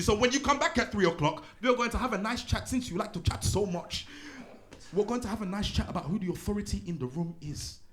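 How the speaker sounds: a hint of sarcastic strict teacher; teacher threatening Rashad with a chat. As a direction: promise a nice chat, with menace.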